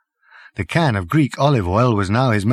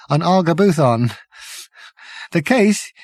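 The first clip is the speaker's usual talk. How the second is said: shaky journalist; 4 semitones higher than usual. Acted. Journalist character voiced.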